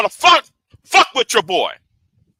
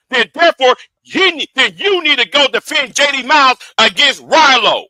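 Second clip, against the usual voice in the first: usual speaking voice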